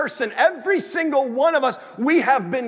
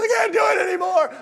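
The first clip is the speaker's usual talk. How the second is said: high-pitched mock meltdown; falling apart; 8 semitones higher than usual. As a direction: act out cracking in front of the class. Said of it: "he fell apart".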